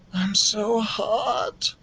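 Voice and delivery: put-on high, slow and soft sick child; feverish mumble. A narrator's reading. Sick boy in bed complaining to his mother, feverish mumble. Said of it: "mumbled Keith".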